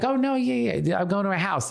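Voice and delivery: high, eager protest; insistent. Retold or imitated. Telling doubters on the plane, eager insistence. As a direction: insist nobody believes you. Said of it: "And I'm like".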